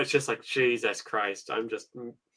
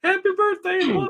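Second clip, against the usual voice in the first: sing-song birthday greeting, pitched way up; mock cheery greeting. Mocking friends who call him with cheery birthday wishes. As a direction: gush like an overeager well-wisher. Mimicking everyone's birthday calls.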